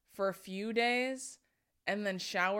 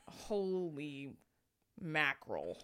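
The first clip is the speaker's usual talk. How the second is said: usual speaking voice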